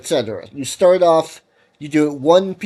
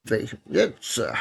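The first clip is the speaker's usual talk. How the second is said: yawning; 3 semitones lower, 8 dB quieter than usual. Real, not acted. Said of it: "I don't know why I'm yawning".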